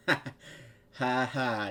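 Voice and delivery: spoken, mocking "ha ha"; mocking laugh. A narrator's reading. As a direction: fake a laugh to make fun. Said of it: "you laugh mockingly".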